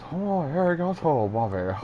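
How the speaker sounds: exaggerated Japanese accent, starting high then falling; put-on Japanese voice; mock Japanese accent. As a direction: imitate a Japanese speaker, hammed up. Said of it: "when someone from Japan hears them".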